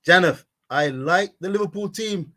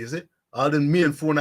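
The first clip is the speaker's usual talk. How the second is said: ordinary delivery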